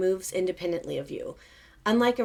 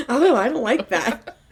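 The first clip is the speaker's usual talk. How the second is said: giggling mimicry of co-host; laughing; 5 semitones higher, 9 dB louder than usual. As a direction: echo it through laughter. Repeats co-host's line laughing.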